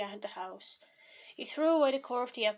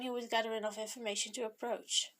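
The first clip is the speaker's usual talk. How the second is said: plain reading voice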